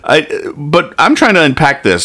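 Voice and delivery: ordinary delivery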